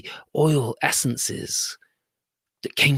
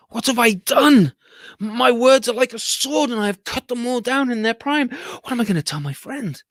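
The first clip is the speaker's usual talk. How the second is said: distraught merchant; 7 semitones higher, 4 dB louder than usual. Acted. Merchant's remorse after parrots fall.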